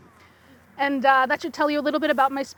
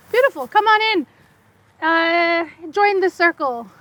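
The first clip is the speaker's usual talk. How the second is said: calling out a welcome; 4 semitones higher, 5 dB louder than usual. Real, not acted. Welcoming latecomers into the circle.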